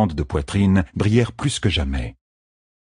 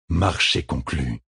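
slow, softer, silky fox-creature; sly creature; 3 semitones lower, 4 dB quieter, slower than usual. A narrator's reading. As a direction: purr the deal closed. Aelfinn male seals the bargain.

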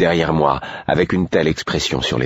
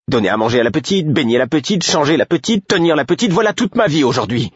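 furious tirade; 9 semitones higher than usual. A narrator's reading. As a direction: pile up the grievances, rising to a shrieking peak. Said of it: "prête à se battre".

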